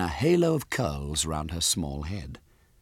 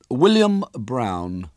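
put-on slow, aloof boy's answer; distant; 5 semitones higher, 5 dB louder, much slower than usual. A narrator's reading. Boy answers the little girl, slow and distant. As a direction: give the name slowly and distantly. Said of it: "he said distantly".

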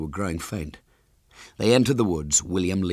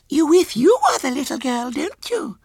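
put-on very high little girl voice; squeaky small child, louder; Violet Elizabeth; 6 dB louder than usual. A narrator's reading. Small girl questions her visitor, high and childish.

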